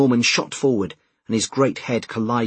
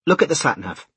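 tense, raised-pitch order; 5 semitones higher, 3 dB louder than usual. A narrator's reading. Ed to Jess in the traffic jam, tense. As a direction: insist with the strain showing. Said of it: "tried to suppress the tension in his voice".

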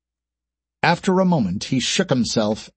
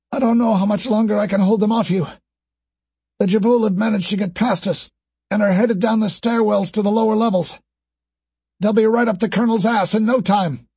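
female soldier voice; 10 semitones higher than usual. Acted. Wounded lieutenant on comms.

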